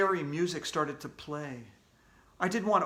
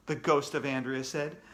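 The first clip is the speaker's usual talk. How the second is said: ordinary delivery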